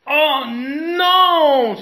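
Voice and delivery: high, loud crying wail; a dismayed crowd crying out; loud crying lament. A narrator's reading. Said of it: "se lamente de nouveau la foule".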